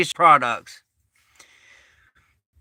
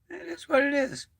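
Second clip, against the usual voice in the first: ordinary talk, nothing marked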